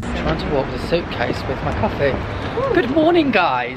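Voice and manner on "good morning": much higher, louder hello; upbeat vlog greeting to camera; bright greeting. Real, not acted. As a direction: greet viewers with a big lift.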